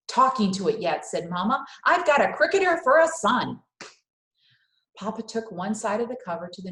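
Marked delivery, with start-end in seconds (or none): 0.1-3.6 loud, much higher mocking mother; exasperated Mama. Mama scoffing at Mario's cricket knowledge, loud and high. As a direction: scoff at the boy's cricket facts.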